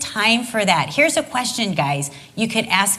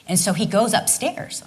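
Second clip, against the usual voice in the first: usual speaking voice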